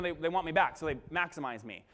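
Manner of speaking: ordinary delivery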